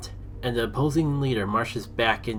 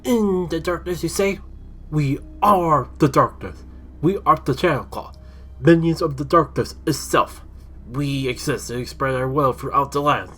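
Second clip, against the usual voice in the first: louder, higher menacing villain voice; sinister villain; 4 semitones higher, 6 dB louder than usual. Acted. Shadow figure announcing his gang to the heroes, menacing boast. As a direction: boast of being the darkness itself. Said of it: "a figure steps out from the shadows and explained".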